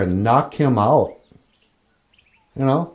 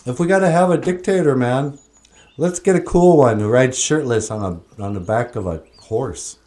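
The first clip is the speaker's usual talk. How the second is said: ordinary talk, nothing marked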